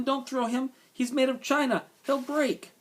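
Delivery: high-pitched urgent plea; crying out. A narrator's reading. Girl begging boys not to throw china rabbit, crying out. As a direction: beg them in a high girl's voice. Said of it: "cried Abilene".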